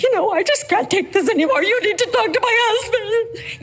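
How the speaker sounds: high, overwhelmed plea to hand it over to the husband; helpless wife at the end of her rope. Retold or imitated. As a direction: let the strength drop and plead to be rescued. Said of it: "And I'm like".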